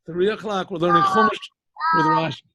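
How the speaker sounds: loud, high-pitched shout to the group; calling out. Real, not acted. Teacher reminding kids of three o'clock class, calling out.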